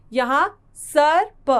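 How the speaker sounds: सर्प said slowly, र sound after स; drawn-out सर्प for learners; slow model word. Shown as a demonstration. Teacher models सर्प slowly for young pupils. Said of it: "स के साथ इसकी मात्रा का उच्चारण किया जाएगा".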